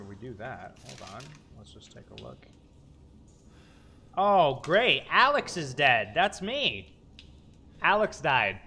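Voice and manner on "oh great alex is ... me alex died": loud sarcastic dismay. Real, not acted. Sees own dead body.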